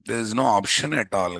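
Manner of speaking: ordinary talk, nothing marked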